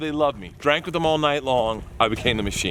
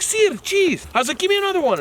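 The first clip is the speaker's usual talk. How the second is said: higher, eager; eager student. Retold or imitated. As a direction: chirp it like a keen pupil. Eager student learning words.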